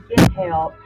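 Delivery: ordinary talk, nothing marked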